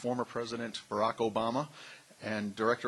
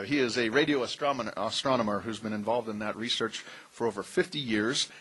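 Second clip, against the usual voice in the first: usual speaking voice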